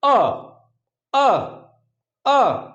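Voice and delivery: अ as a short open a vowel, repeated slowly; short a sound. Shown as a demonstration. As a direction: model the vowel अ for students. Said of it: "वो होती है हमारी".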